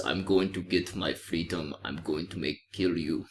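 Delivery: a lightly voiced low, quiet, plodding gladiator; low mumbling imitation of a stiff movie warrior; mocking Atticus. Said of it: "and he talks like this".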